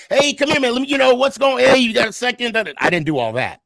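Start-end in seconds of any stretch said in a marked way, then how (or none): 0.1-2.6 high, chummy buddy voice; annoying frat bro. Retold or imitated. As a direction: play the overfriendly fan. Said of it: "I didn't say".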